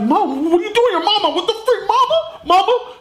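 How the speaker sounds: high, incredulous outburst rising on Mama; shocked son. Acted. Son finding his mother alive, high shocked disbelief. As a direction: squeal it in disbelief at seeing Mom.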